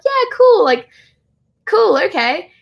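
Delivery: imitated easygoing yes, pitched high; high, casual mock acceptance; breezy agreement. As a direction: quote an offhand okay in a high voice. Voicing author's casual agreement.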